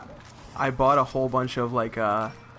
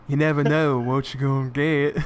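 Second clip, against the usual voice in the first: Forrest Gump. Retold or imitated. Player echoing partner's film quote, drawled impression. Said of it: "how's the quote go?".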